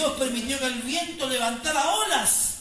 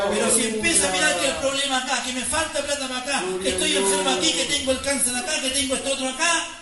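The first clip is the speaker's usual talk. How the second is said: preaching at full force; 3 semitones higher, 8 dB louder than usual. Acted. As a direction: preach it out to the congregation, almost singing. Preacher builds to climax.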